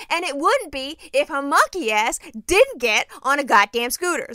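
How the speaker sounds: loud, slow furious retort. Retold or imitated. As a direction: spit it back at him.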